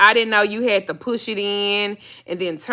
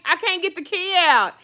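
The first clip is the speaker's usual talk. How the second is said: whiny plea; 9 semitones higher than usual. Retold or imitated. Her own whiny quote.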